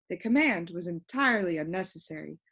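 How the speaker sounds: usual speaking voice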